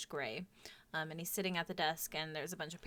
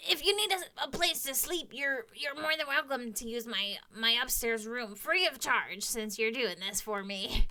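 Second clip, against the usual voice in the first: kobold voice; 3 semitones higher than usual. Acted. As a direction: squeak it out like a helpful kobold. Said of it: "I'm so done talking as kobolds".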